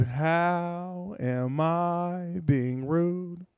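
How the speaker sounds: extremely drawn-out question; slow-motion echo of the other person; mimicking slow speech. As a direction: copy her stretched syllables mockingly. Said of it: "Why are you talking like that?".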